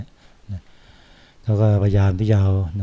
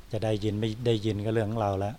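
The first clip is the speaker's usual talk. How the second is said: much softer, as if off-mic; away from the mic; 11 dB quieter than usual. Shown as a demonstration. Monk shows how his teacher talks, mic held away, very soft. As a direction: speak with the microphone held well away. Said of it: "อย่างหลวงพ่อนิยมพูดอย่างนี้นะ".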